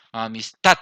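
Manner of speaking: "amistad" with a loud, forceful "tad"; stress on last syllable. Shown as a demonstration. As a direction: punch the ending "tad" of "amistad". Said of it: "donde mayor hace la fuerza esa sílaba".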